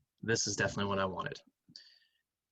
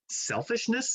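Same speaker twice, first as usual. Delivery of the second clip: incredulous; 12 semitones higher, slower than usual. Real, not acted. Incredulous reading of option.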